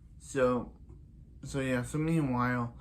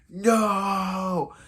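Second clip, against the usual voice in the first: a louder, higher mock cry of despair; outcry; 6 semitones higher, 3 dB louder than usual. Harry crying out as Zoom gets away, mock cry.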